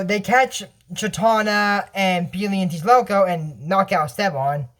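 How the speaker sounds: ordinary delivery, nothing marked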